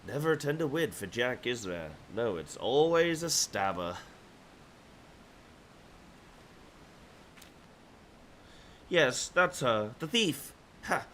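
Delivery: sneering, theatrical villain. Acted. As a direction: play it smug and scheming. Reads villain NPC lines.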